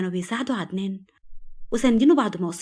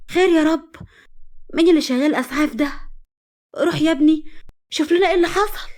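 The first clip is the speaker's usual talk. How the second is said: high, raised anxious questions; worried mother; 8 semitones higher, 4 dB louder than usual. Acted. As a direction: send your son off anxiously, voice lifted high. Worried mother hears the ambulance.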